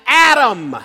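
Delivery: crying out a name. Retold or imitated. God calling out to the hiding man, crying out. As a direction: call out as if across a garden. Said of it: "He cried out".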